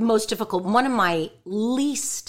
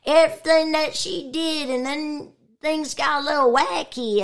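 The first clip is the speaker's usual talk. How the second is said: higher, quirky cartoon-kid voice; a quirky, higher voice, half-playful; Chrissy Cavanaugh voice; 6 semitones higher, 3 dB louder than usual. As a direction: slip into her higher, quirky character voice. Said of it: "Chrissy Cavanaugh talked like this".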